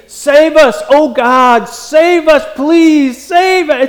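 high, urgent begging with SAVE hit hard each time; insistent, high-pitched cry for rescue; emphatic plea. Shown as a demonstration. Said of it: "this emphatic thing".

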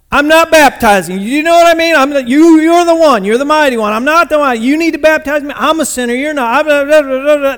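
loud, high, insistent protest; emphatic John. Retold or imitated. John refusing to baptize Jesus, emphatic and loud. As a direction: argue over the other person, refusing loudly. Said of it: "this was an emphatic conversation".

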